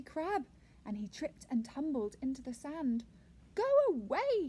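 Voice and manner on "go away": loud, high snap; snapped. A narrator's reading. Crab sending the tickling octopus off, angry snap. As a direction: snap it out sharply and loudly. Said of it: "he snapped".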